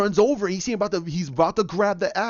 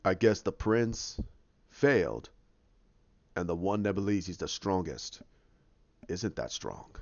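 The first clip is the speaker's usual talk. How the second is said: soft, deep gloating with long pauses; Thanos; 9 semitones lower, 6 dB quieter than usual. Retold or imitated. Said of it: "you have Thanos say this great line".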